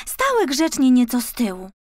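ordinary delivery, nothing marked

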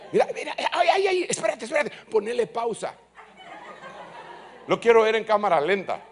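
high, laughing mock excitement. Preacher mimicking a film viewer, laughing excitedly. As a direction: cry out laughing to pause the film. Imagined viewer watching the shooting scene.

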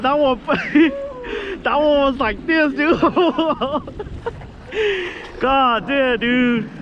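ordinary talk, nothing marked

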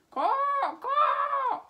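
very high, loud cawing shout; bird caw. Acted. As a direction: caw like a big bird in a tree. The bird cries.

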